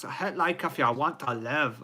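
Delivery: botched Scottish catchphrase; bad Scottish accent. Streamer trying an accent without meaning offence, bad Scottish accent. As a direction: attempt Scottish and miss. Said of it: "I'm just trying to do an accent".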